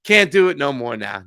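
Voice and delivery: ordinary talk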